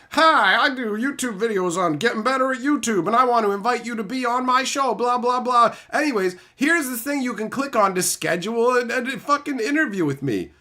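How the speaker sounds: high mock-friendly YouTube coach voice; mocking YouTuber. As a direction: parody a spammy YouTube guru. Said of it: "some fucking random guy going".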